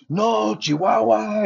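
high, slow insistent correction; retold yell. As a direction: drag out the town name, high and firm. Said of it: "He's yelling at me".

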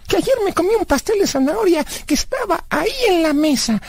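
put-on high-pitched kid owning up; high cartoonish confession; childlike Blas, confessing. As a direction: confess in a high, naive character voice.

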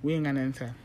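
ordinary talk

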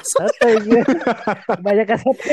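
laughing. Real, not acted. Laughing self-mockery.

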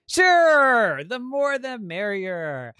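loud, high, drawn-out welcome; jolly talking bed. Acted. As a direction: boom a long, hearty yes, then stretch the rest.

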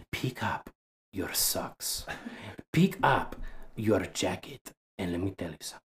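put-on hushed, menacing accented grandma; mad grandmother's accent. Grandmother orders grandson to tidy up, quiet mock threat. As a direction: scold low and soft in grandma's accent. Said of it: "her accent just stuck with me".

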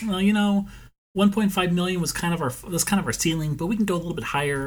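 high-pitched mock negotiating buyer; pretend house buyer. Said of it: "they just pretend like".

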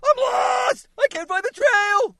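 panicked little bug, very high and loud; First Bug/character. Shown as a demonstration. Lost bug who can't find the trail, panicked shouting. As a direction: scream it as a lost cartoon bug. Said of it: "the first Bug in a Bug's Life".

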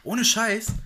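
hoch und laut bekräftigen; nachdrücklich. Real, not acted. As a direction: begeistert beteuern. Bekräftigt begeistert. (laut und begeistert)

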